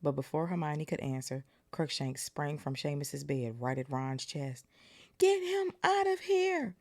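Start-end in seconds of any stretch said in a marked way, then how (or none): 5.2-6.8 much higher and louder, slowly drawn out; bellowing. Acted. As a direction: bellow in panic at the cat. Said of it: "Ron bellowed".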